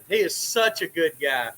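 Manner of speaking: ordinary talk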